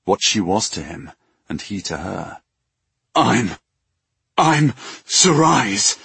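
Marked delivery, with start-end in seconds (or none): 3.1-6.0 faltering. Acted. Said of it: "he began, but couldn't finish".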